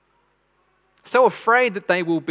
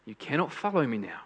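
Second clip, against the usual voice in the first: put-on softer, patient voice for a little child; gentle with a child; 3 semitones lower, 7 dB quieter than usual. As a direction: ease it out like calming a small child. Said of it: "like sitting down a little child".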